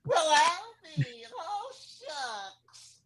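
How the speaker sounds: hammed-up high yokel on the phone; changed goofy voice. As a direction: squeal it out like a yokel. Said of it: "I'd totally change my voice".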